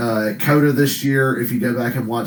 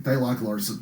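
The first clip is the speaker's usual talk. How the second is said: usual speaking voice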